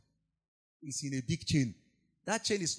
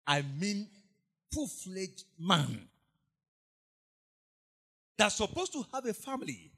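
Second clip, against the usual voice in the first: ordinary talk, nothing marked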